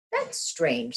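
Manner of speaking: high, wondering hen voice; a hen scratching her head; puzzled hen. Acted.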